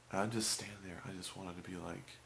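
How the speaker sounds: ordinary talk, nothing marked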